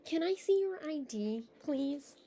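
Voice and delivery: normal talking voice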